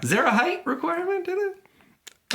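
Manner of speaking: squeaky high repeat of the question; high mock voice. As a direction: push it way up into falsetto. Repeats the customer's line.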